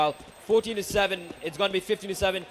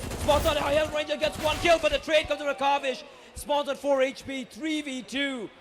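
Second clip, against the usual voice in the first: excited play-by-play; 6 semitones higher than usual. Real, not acted. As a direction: call the action at full hype. Fast play-by-play calling.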